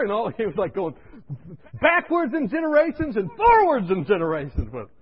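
normal talking voice

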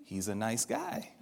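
hushed, slow approving murmur; quiet inner thought. Retold or imitated. Inner thought about kind stranger.

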